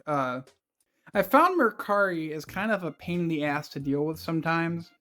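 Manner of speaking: normal talking voice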